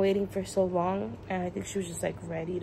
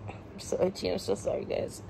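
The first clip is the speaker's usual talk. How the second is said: whispering; 5 dB quieter than usual. Real, not acted. Drowsy mom apologizes to viewers.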